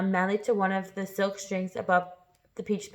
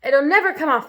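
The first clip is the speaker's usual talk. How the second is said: higher, louder cheerful line; bright, raised cheerful voice; brightly; 6 semitones higher, 6 dB louder than usual. Acted. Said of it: "the earthworm said brightly".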